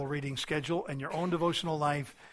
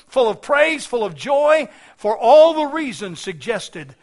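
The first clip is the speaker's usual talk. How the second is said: loud, high-pitched pulpit exhortation; full-force preaching; 6 semitones higher, 9 dB louder than usual. Real, not acted. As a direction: preach it hard, voice raised and urgent.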